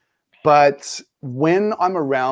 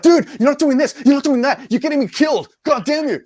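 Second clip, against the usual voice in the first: angry gamer mate; 12 semitones higher than usual. Retold or imitated. Gaming mate angrily blaming him for getting killed, mock rant. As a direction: play the annoyed friend bagging him out. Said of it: "does he get angry at you".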